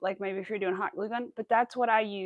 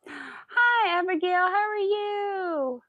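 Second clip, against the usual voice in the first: high, stretched-out hello; loud, high welcome; sing-song greeting; 8 semitones higher, 5 dB louder, much slower than usual. Real, not acted. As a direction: call out to a newcomer with a lifted, held last word. Calling greeting to arriving viewer.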